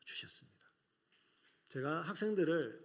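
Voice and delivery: normal talking voice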